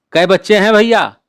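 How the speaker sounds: lightly voiced excited girl; eager little sister. A narrator's reading. Little sister calling up to her brother on the stool, eager.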